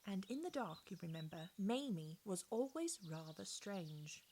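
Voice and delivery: usual speaking voice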